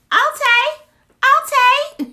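sudden high loud yelp; yelled. Real, not acted. Reacting to a candle scent, high loud yell.

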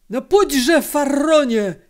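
high, loud furious shout; angry yell. A narrator's reading. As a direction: bellow at the stubborn horse. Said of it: "wrzasnął rozłoszczony".

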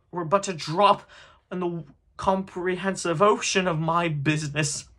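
high, loud impassioned declamation; tormented ghost's ringing rebuke; crying out. A narrator's reading. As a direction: hammer out each cause in anguish. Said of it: "cried the ghost".

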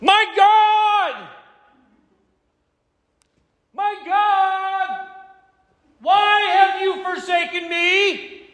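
high, desperate wailing shout; a forsaken man screaming up to heaven; anguished cry to God. Shown as a demonstration. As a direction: cry it out from the depths of despair, slowly at the end. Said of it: "I'm gonna give you an interpretation of maybe how it would go if you were actually to pray this".

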